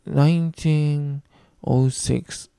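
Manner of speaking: "Nineteen" stretched out slowly, then a short break before "oh six"; 「Nineteen」と「oh six」を区切って; split into hundreds. Shown as a demonstration. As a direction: read "Nineteen", pause, then "oh six" as two chunks. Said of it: "こんな言い方をしますね".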